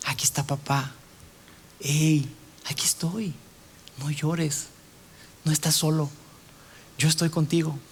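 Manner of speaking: soft, low reassurance with long pauses; tender parent. Acted. Father at eye level with his small child, tender and quiet. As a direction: comfort a crying little one, hushed. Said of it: "cuando le hablas a un niño chiquito".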